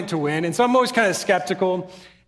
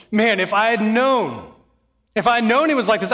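higher and louder, urgent plea; impassioned; 4 semitones higher, 4 dB louder than usual. Acted. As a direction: cry out with mounting regret. Preacher imagines eternity dawning.

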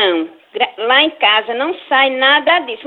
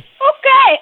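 surpresa indignada; 8 semitones higher, 5 dB louder than usual. Real, not acted. Indignant reply to an accusation.

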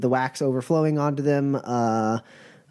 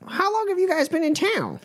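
much higher, pointed question; pitched-up suspicious zealot; puritan voice. Acted. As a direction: probe the newcomers. GM plays puritan.

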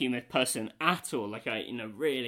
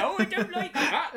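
high, laughing mock villager; prissy villager disapproval; 13 semitones higher, 5 dB louder than usual. Quoting the game's villager to a friend, high laughing mock disapproval. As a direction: squeak out the disapproval like a game NPC. Villager sound bite voiced.

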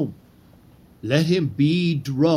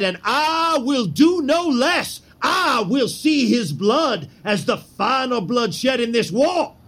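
fierce shouted vow; 6 semitones higher than usual. Acted. Conspirator vows to kill the tyrant.

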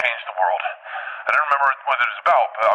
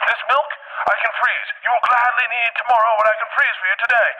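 much higher, grand pitchman voice; mock visionary; 9 semitones higher than usual. Dream inventor proclaiming to the crowd, high and grand. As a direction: sell freezing like a miracle. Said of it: "I was like".

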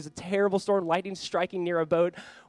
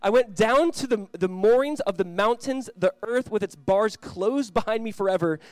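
slow, lifted reading of the psalm; scripture reading; 3 semitones higher, 4 dB louder, slower than usual. Acted. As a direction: read it out like a solemn prayer over music. Scripture reading over music.

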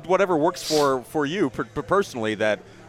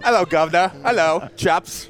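higher, hammed-up English accent greeting; mock English accent; 4 semitones higher, 3 dB louder, slower than usual. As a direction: put on a silly English accent, drawing out the end. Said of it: "See, you sound stupid".

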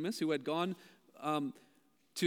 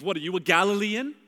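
mocking insult; 6 dB louder than usual. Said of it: "they're insulting Nicodemus".

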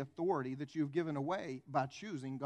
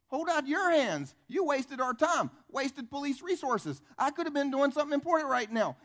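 acted police scolding; 9 semitones higher, 6 dB louder than usual. Police officer scolding owner for wasted time, acted annoyed rebuke.

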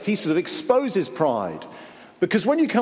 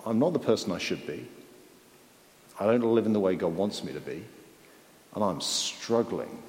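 humble admission; 6 semitones lower, 5 dB quieter than usual. Pretend. Preacher voicing a humbled person's thoughts, quiet and lowly. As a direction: lower and softer, subdued. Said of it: "But if you think".

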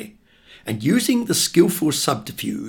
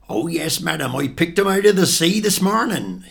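fishmonger's higher-pitched Devon patter; breezy Devon fishmonger, telling a bare-faced lie; broad Devon accent; 6 semitones higher, 4 dB louder than usual. Acted. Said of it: "in his broad, deafened accent".

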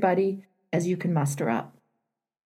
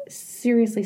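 ordinary talk

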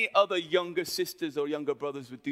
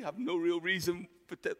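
usual speaking voice

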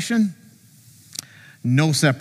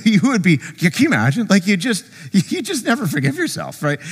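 ordinary talk

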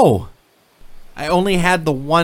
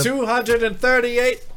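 surprised; 11 semitones higher, slower than usual. Acted. Gatekeeper answers eagerly.

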